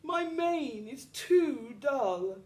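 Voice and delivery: high, slow lion lament; lightly voiced lion complaining. A narrator's reading. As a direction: moan it slowly, high. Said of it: "cried the lion".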